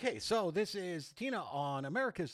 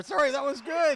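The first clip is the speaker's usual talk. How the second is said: much higher and louder burst of praise; excited praise; 13 semitones higher, 13 dB louder than usual. Real, not acted. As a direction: gush over the singer. Host praising after interruption.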